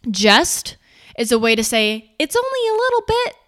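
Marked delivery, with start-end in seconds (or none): 2.2-3.3 much higher, shrinking voice; small timid voice. Shown as a demonstration. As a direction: sound small and apologetic. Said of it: "It's with power".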